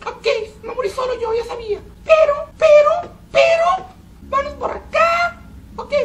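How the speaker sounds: strange squeaky high-pitched voice, whiny and teary; odd high squeak while gaming; weird high voice. Real, not acted. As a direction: talk in a strained high whine. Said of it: "¿Por qué ando hablando así?".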